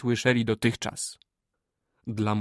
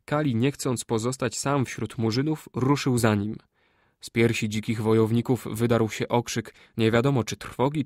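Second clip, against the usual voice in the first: plain reading voice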